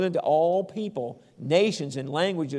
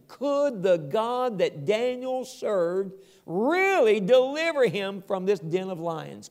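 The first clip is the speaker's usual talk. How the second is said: usual speaking voice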